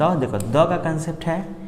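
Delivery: usual speaking voice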